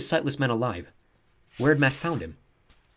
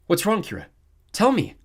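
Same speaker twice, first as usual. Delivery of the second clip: rising, louder urgent question; urgent plea; 10 semitones higher, 7 dB louder than usual. A narrator's reading. Thomas begging Kira to explain, urgent. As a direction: press her to tell you.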